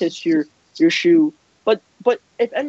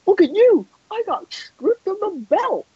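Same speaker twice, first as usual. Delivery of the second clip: mocking whine; 10 semitones higher than usual. Rival mimicking the stripped champion, mocking whine. Mocks rival as whining loser.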